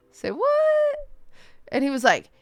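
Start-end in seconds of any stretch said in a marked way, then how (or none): 0.1-1.0 much higher, squeaky so what; stunned, voice jumping way up; shocked disbelief. Retold or imitated. Shocked reaction to demon.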